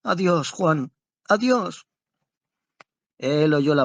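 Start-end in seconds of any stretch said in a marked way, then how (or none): 0.0-1.9 a high, tearful farewell broken by sobs; voz entre sollozos. A narrator's reading. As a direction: say goodbye choking back sobs. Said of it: "prorrumpió con voz cortada por los sollozos". (despedida entrecortada por sollozos)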